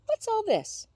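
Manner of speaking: delighted puzzled exclaim; surprised. A narrator's reading. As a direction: wake up and find a surprise. Waking to birthday presents.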